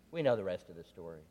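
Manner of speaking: ordinary talk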